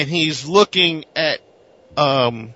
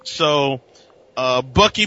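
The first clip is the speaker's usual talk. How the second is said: ordinary delivery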